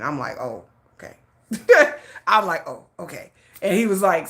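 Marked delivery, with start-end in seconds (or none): 0.4-1.2 low, very soft acknowledgement; subdued reply. Retold or imitated. Retells own taken-aback reply. 2.7-3.3 low, very soft acknowledgement; subdued reply. Retold or imitated. Repeats own taken-aback reply.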